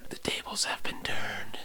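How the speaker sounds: soft, hushed plot twist; conspiratorial stage whisper; mock dramatic whisper. Co-host joins the whispered reveal.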